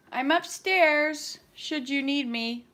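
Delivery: loud, slow call upstairs; yelling across the room. Real, not acted. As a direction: call out to someone downstairs. Calling to husband downstairs.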